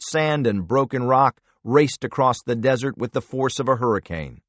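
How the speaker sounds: ordinary delivery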